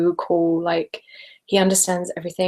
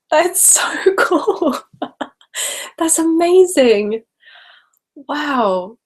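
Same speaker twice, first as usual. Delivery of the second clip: higher, louder, slow gushing delight with a laugh; 7 semitones higher, 4 dB louder, much slower than usual. Real, not acted. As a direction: melt over the news, laughing. One-year-old loves her videos.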